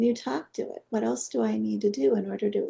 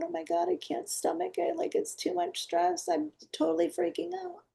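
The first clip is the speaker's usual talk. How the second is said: higher, softer mock panic of the gut; panicky inner voice; 7 semitones higher, 3 dB quieter than usual. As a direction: act out the gut panicking in a light, breathy-free higher voice. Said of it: "you're freaking out".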